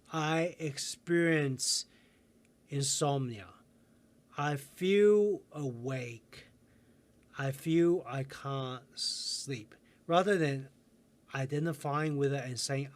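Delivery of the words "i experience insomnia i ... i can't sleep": very slow, each phrase set apart; calm, unhurried self-talk; slow, deliberate reframing. Shown as a demonstration. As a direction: say it slowly like a new habit. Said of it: "but just use the word".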